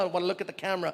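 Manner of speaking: ordinary talk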